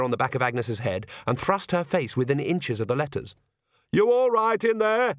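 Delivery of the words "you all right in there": high, slow call to the next room. Acted. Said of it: "Nanny called from the next room".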